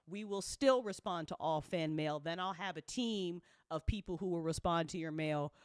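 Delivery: usual speaking voice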